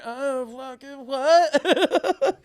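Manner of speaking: very slow, groggy, drawn out; dazed waking up. Retold or imitated. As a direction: come to and slur it out confused. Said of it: "And I'm like".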